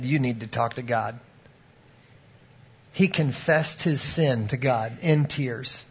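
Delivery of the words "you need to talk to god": low, soft, retold advice; gentle counsel. Preacher to the young man weeping on him, gently. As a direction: say it quietly and lower, as counsel.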